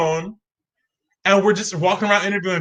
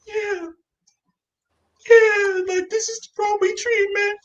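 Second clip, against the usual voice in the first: high, teary squeak of delight; overwhelmed squeal. Retold or imitated. Starstruck teen among Broadway casts, teary squeal. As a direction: gush like you might cry from joy.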